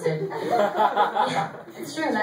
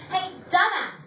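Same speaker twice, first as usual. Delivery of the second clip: much higher, laughing mock cat voice; voicing the cat; 11 semitones higher than usual. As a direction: play the cat taunting its owner, high and giddy. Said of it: "Teddy was going".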